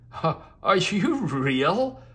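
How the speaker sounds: put-on a shy girl peeking from the tree; small girl asking in amazement; little girl voice. Hidden little girl speaks.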